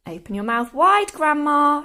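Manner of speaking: loud, rising lead-up to "wide"; calling instruction. Acted. George to Grandma with the spoonful, calling instruction. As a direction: urge her loudly to open up.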